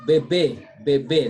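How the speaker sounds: BEBE with both E's as "ay"; acute-accent pronunciation. Shown as a demonstration. French teacher corrects student's reading, firm acute-accent "bebe". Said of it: "Remember the acute accent?".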